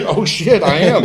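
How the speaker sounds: high-pitched, laughing realisation; high, tickled mock dismay; amused alarm. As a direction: retell the oops moment through a laugh. Retold sudden realisation, buddies laughing.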